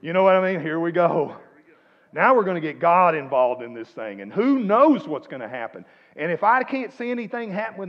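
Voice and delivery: loud, driving preacher build; loud rousing sermon push; preaching loudly. Real, not acted. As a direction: drive it home with full volume. Preaching at full force.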